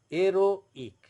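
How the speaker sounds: slow, loud "heroic", split as "ero... ik"; French "heroic" with a pause inside; pause before I. Shown as a demonstration. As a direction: break "heroic" before the "ik", dropping the H. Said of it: "you have to pause for a moment".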